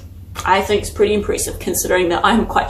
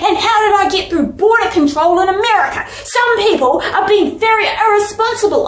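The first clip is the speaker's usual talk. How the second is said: mad rant; 9 semitones higher than usual. Retold or imitated. Dreamer fuming at careless airport staff, high indignant rant. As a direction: rant about whoever let her fly without a passport. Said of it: "then I was like".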